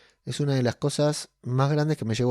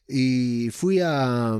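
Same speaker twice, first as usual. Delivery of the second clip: ordinary talk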